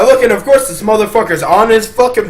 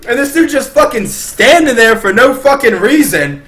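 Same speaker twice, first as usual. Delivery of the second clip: ordinary delivery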